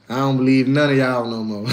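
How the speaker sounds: ordinary talk, nothing marked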